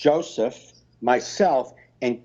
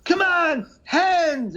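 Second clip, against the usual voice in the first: high-pitched frontman urging the crowd; mock concert yell, pitched way up; imitated stage shout; much slower than usual. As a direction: holler it like a singer working an arena. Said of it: "with the stare and be like".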